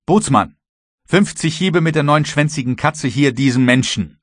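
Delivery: loud, high angry command; shouted order. A narrator's reading. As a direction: call out a punishment across the deck. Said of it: "rief James Playfair". (laut gerufener, zorniger Befehl)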